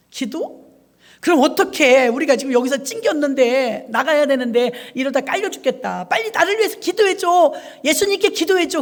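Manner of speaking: put-on a scared kid crying out for help; panicked child. 갇힌 아이 다급한 외침. (겁에 질린 아이가 다급하게 외침)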